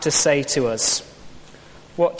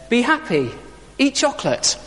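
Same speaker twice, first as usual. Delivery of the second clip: high, pat slogan with falling ends; chirpy Easter Bunny answer; 8 semitones higher than usual. Acted. Preacher voicing the Easter Bunny's answer to hard life, chirpy. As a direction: chirp it as a pitched-up, unhelpful refrain.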